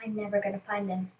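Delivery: very soft, low, quick murmur; hopeless inner thought. Retold or imitated. Lost child thinking to herself, hushed despair. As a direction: say it under your breath, as if thinking it. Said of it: "I was, like, thinking".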